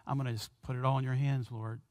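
soft, quick murmur of surrender; hushed prayer. Acted. A believer surrendering everything to the Lord, soft and quick. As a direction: drop to a fast private whisper to the Lord.

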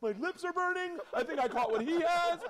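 high-pitched comic alarm; mock panic. Bather in a two hundred degree room, mock panic. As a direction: wail it in hammed-up distress.

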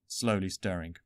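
plain reading voice

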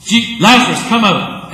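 high, loud happy call; cheerful loud call. Pretend. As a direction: call him out with joy. Replaying the call.

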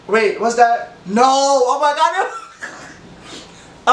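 high, laughing "oh my god"; horrified as the memory hits; shocked, amused realization; surprised. Real, not acted. Remembers embarrassing moment.

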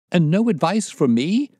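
high, loud, desperate cry; breaking voice. A narrator's reading. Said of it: "cried Blessington in a breaking voice".

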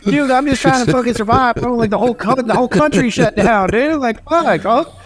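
high, pleading cry of desperation; desperate plea. Retold or imitated. Business owner pleading with the debt collector, high and desperate. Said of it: "I'm like".

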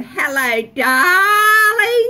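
high, loud, sing-song showgirl greeting; Jeannie Little impression. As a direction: gush it like a flamboyant TV star. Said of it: "That's my very worst, um, impersonation".

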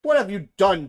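loud, high demand. A narrator's reading. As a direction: shout the accusation. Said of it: "demand the warthogs".

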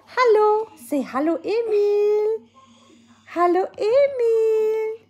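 high, slow sing-song hello. Real, not acted. Mother greets her baby son, high sing-song baby talk. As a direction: coo the greeting to the little one.

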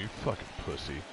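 quick hushed jab, barely voiced; fast whispered insult. Stranger whispering a taunt at a Red Sox fan. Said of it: "whispered in my ear".

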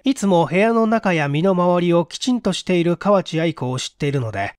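ordinary delivery, nothing marked

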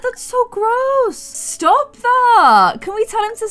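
high, disgusted telling-off. Real, not acted. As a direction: squeal at the gross dog. Dog drinking from toilet.

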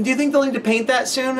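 put-on high curious little boy; kid voice. Kid asks about the drawing, in a high voice. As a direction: ask it like a small kid.

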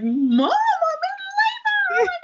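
high, slow, loud excited cry; thrilled shout to mom; excited yell. Retold or imitated. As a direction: burst out with the news. Announcing labor excitedly to mom.

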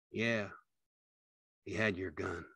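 quiet, drained answer; subdued. A narrator's reading. George answering Carlson about the gun, quiet and subdued. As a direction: answer softly with little energy.

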